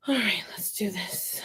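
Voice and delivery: soft whisper to herself. Real, not acted. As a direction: whisper it under your breath. Whispered to herself while sewing.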